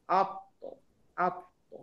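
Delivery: আপ then ত, the tongue set on the gum ridge; demo sound ত. Shown as a demonstration. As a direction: slowly model আপ, ত. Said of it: "এইটার বেলা আমি বলবো ত".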